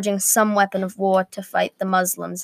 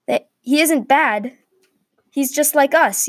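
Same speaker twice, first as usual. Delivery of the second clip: higher, lightly raised protest; mild yell; 6 semitones higher, 3 dB louder than usual. A narrator's reading. Man protests from the other tower, mild yell. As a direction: call it across, holding back the volume. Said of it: "yelled a man from the other tower".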